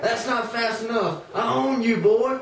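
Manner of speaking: high, rising to a loud last word; scolding master. Acted. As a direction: lord it over him, ending loud. Master scolding slave in field.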